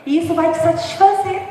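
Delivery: normal talking voice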